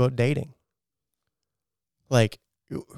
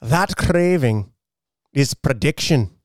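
hammed-up, higher-pitched Scottish reading; Scottish accent; 4 semitones higher than usual. Shown as a demonstration. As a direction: read it in an amateur Scottish accent. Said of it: "can you read this in a Scottish accent".

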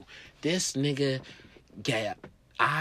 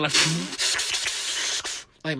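ordinary delivery

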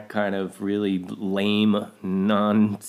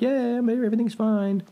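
carefree and oblivious; 13 semitones higher than usual. Retold or imitated. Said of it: "whistling Dixie".

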